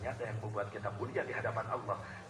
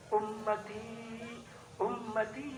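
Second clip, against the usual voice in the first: mild weeping prophet; 9 semitones higher, much slower than usual. A narrator's reading. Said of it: "sering nangis".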